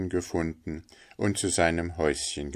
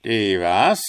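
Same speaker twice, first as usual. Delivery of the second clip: loud, startled screech; 3 semitones higher, 9 dB louder than usual. A narrator's reading. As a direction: screech the question in disbelief. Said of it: "kreischte der Wasserratz". (laut gekreischte, entsetzte Rückfrage)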